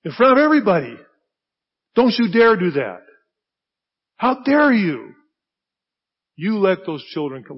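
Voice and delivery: loud, fierce don't you dare; Jesus scolding the disciples hard; indignant rebuke. Acted. Jesus to his disciples, a loud indignant rebuke. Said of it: "he says".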